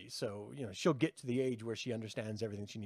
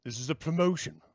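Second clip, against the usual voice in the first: mock ad read; 3 dB louder than usual. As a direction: pitch the soul deal like a commercial. Mock promo read for Satan.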